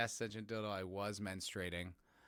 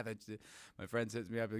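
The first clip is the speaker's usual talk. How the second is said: normal talking voice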